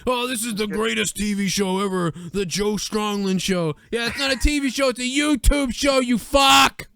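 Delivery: mock wrestler bluster ending in a shout; hammed-up Hogan ranting. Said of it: "just keep yelling at him".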